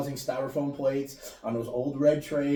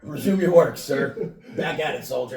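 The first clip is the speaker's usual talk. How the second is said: mock stern order; 3 semitones higher, 8 dB louder than usual. Boss catching a worker with his pants open, mock stern loud order. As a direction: bark it like a boss who caught a worker.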